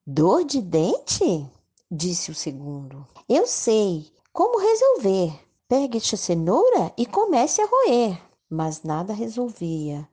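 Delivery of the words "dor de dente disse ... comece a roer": rabbit voice. Acted. Second rabbit speaks.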